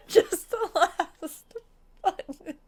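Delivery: very slow, drawn-out whine; tearful whimper. Real, not acted. Player begging for one last button, tearful whimper. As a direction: whimper it weakly, close to crying.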